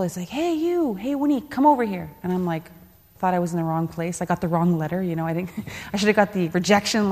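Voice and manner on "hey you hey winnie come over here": mock yell across a pool, pitched much higher; high-pitched imitation of a coach hollering a name; retold coach yell. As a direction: call him over like a coach shouting down the deck. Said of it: "he yelled across the pool".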